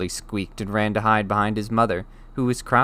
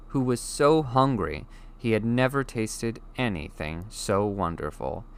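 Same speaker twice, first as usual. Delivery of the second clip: plain reading voice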